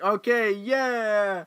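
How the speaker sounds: pumped-up fan reaction; excited cheer. Retold or imitated. Acts out own cheering reaction.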